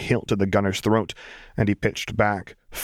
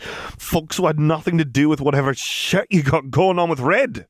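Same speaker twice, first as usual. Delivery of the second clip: furious; 8 semitones higher than usual. A narrator's reading. Furious bartender.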